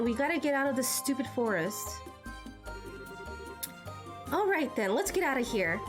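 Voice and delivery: usual speaking voice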